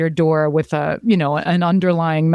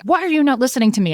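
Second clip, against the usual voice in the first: mock furious yell, higher and louder; hammed-up blow-up at someone not listening; retold angry outburst; 8 semitones higher, 3 dB louder, faster than usual. Admitting her own outbursts, playing a mock angry yell. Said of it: "I've done this".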